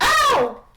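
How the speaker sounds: high, loud acted "ow"; pained yelp. Merlin bonked on the head by Tisha, acted loud yelp.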